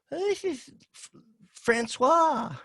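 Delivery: high put-on voice of his mother, cheerfully introducing; high mocking mom voice; mother's voice. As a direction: squeak it up like his frail old mom chirping a name. Voicing his mother introducing man.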